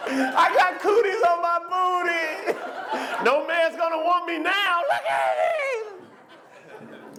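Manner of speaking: woman in the bathroom wailing at the seat cover, high and drawn out; distraught shout. Pretend. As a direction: wail it out in mock despair. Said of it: "you're like".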